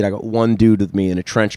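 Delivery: ordinary delivery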